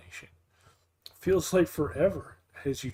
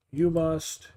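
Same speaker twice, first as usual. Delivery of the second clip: ordinary delivery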